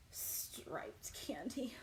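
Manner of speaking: slow, soft whispered words. A narrator's reading. Storyteller whispering the candy list. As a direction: breathe it out dramatically. Reading the days dramatically.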